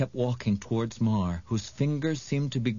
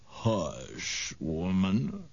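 low, contemptuous witch; oily witch voice; 3 semitones lower, much slower than usual. A narrator's reading. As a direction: hush her with low scorn. Mar the witch speaks.

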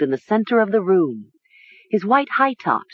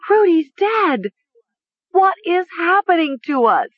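screaming; 12 semitones higher, 3 dB louder than usual. A narrator's reading. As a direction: scream it in panic and grief. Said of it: "she heard herself screaming".

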